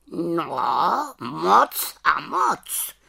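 high raven cry, very drawn out; exclaiming raven. A narrator's reading. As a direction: call it out emphatically as a raven. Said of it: "zvolal Havran". (zvolání havrana, protáhle)

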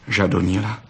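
ordinary delivery, nothing marked

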